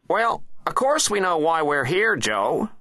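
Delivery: high, loud presidential comeback; impatient president. A narrator's reading. President replying to his Attorney General, high and impatient. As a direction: snap back in a high character voice. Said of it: "replied the president".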